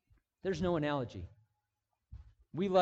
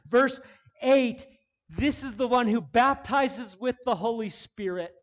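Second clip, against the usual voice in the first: louder, higher, driving sermon delivery; full-force preaching; 8 semitones higher, 11 dB louder than usual. Acted. Pastor urging the congregation on, full-force preaching. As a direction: preach it hard to the room.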